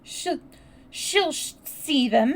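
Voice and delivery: high, broken stammer; halting, tearful outburst; gasping between sobs. A narrator's reading. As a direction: gasp it out through sobs. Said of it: "gasped out between two sobs".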